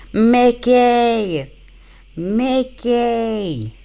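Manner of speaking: high, drawn-out call for Mickey; mock Minnie hollering after Mickey. Playing Minnie chasing after Mickey, high drawn-out calling.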